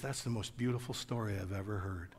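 hushed, low, moved voice; very soft, understated tearful awe; retold weeping. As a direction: imitate someone softly moved to tears. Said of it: "starts to weep".

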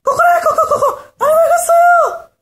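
very high, loud squawking rooster cry; crowing rooster. Acted. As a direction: crow it out like a frantic rooster. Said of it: "닭이 시끄럽게 울고 있었어요".

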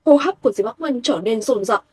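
usual speaking voice

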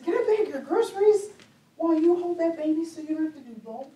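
high, loud, eager offer of help. Retold or imitated. Woman offering to bag a young mother's groceries, eager and high. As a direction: pitch it way up, keen to help.

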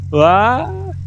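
sudden high, loud "uah" cry; startled yelp. Real, not acted. Gamer startled by his truck, high yelp. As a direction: yelp in surprise as the truck slips.

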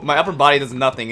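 ordinary talk, nothing marked

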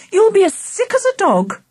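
high-pitched warning from mum; scolding mum. A narrator's reading. Mum warning greedy Charlie, scolding.

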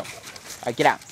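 ordinary talk

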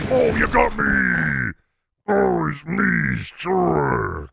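slow, stretched-out mock ogre; Shrek. Shrek repeating the catchphrase, in a slowed-down character voice. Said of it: "in a non-Shrek voice".

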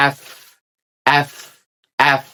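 "এফ" with teeth on the lip for f. Shown as a demonstration. Teacher shows teeth on lip, "এফ" with clear friction. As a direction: finish "এফ" on an airy, held f. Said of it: "দাঁতগুলো".

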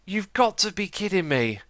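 deflated fake disbelief; bad acting. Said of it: "I am a terrible actor".

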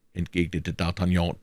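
usual speaking voice

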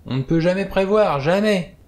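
put-on high, loud lament of an old sea dog; drunk old sailor. A narrator's reading. Old sailor warns fellow passenger, high and loud. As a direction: moan it, swaying and doom-laden.

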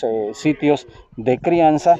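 ordinary talk

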